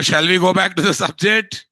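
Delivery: usual speaking voice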